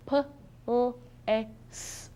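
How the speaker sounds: "p-, l-, a-, s-" as separate sounds; sounding out words. Shown as a demonstration. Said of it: "I'm gonna sound out the answer".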